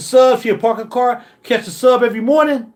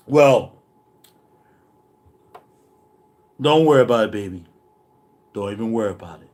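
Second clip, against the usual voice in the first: smooth reassurance; 11 semitones lower, 3 dB quieter than usual. Acted. Producer sweet-talking the girlfriend in the booth. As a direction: purr it low to calm her down.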